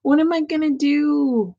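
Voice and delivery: lightly acted despair; discouraged. Reader voices a man in a hopeless situation, discouraged. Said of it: "He's feeling discouraged".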